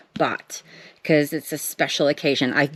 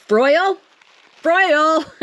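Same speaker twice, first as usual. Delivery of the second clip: excited calling out; 10 semitones higher, 5 dB louder, slower than usual. Real, not acted. Calling out to admired artist.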